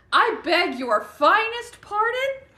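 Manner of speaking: put-on high, slow, indignant Irish accent; offended Irishman, drawn out; Bram Stoker. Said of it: "speak on behalf of Bram Stoker".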